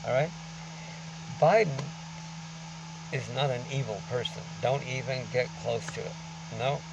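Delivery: ordinary talk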